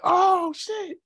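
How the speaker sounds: high shocked exclamation; surprised. Retold or imitated. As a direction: blurt it out, caught off guard. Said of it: "I was like".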